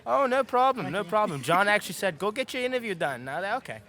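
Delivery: steady British accent held on request. Shown as a demonstration. Voice actor shows the interviewer his British accent. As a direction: keep the British accent going, easy and casual. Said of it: "So now I can switch back to the New York one".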